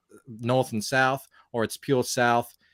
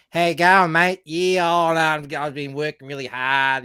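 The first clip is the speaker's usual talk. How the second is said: put-on broad Ocker accent; 4 semitones higher, 5 dB louder than usual. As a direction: play up the broad Ocker Australian twang. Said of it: "that's how he talks".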